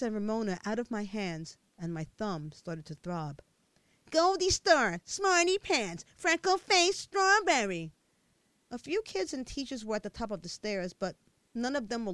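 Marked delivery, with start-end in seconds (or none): 4.1-7.9 high, loud schoolyard taunt chanted; taunting names. A narrator's reading. Kids jeering names at the girl on the stairs, loud mocking taunt. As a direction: jeer the nicknames at her.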